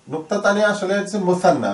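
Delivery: usual speaking voice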